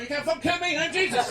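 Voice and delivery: high, loud hammed-up boast; triumphant shout. Invincible Jesus yelling from a BMX, hammed-up triumphant shout. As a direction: crow it out like you're invincible.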